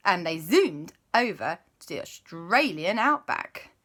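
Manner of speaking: ordinary delivery, nothing marked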